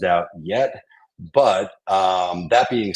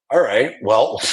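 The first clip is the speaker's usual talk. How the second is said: ordinary talk, nothing marked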